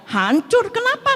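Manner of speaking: retold surprise, leaping high at the end; imitated startled question; mildly shocked question. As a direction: echo it, then ask why in a high pitch.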